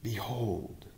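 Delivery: a faint breath of a word; Mary whispers her answer to the angel; whispered. A narrator's reading.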